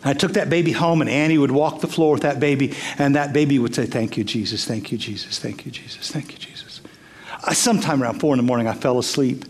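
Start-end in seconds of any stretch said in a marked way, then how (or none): 3.8-6.8 soft, fading whispered thanks; whispered prayer. Retold or imitated. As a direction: let it fade to a hush, like a sleepy prayer. Baby's whispered prayer retold.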